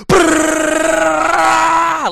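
high, loud rolling gunfire brrr; spray the gunfire sound out; machine gun noise. Retold or imitated. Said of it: "I was just like".

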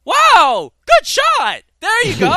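very loud, high mock exclamation; [name]. Officer praising a trainee's good shot, hammed-up excited shout. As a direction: burst into over-the-top shouted praise. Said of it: "they exclaim when you do a good shot".

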